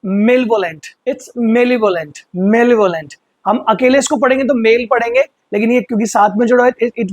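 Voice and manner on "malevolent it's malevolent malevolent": malevolent said slowly as muh-LEV-uh-lent, stress on LEV; careful pronunciation. Shown as a demonstration. Teacher drills the opposite word for students, slow and clear. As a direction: repeat malevolent slowly, stress on the second syllable. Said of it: "opposite word क्या है?".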